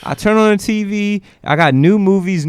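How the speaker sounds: high, loud and eager praise; excited. Real, not acted. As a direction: gush like a happy fan. Raving about Netflix.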